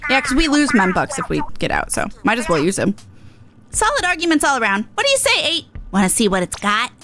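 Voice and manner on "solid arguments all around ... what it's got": higher, brash little idol voice; cocky and upbeat, pitched up; Pearl voice. Acted. Streamer voicing Pearl to Eight, pitched up and cocky.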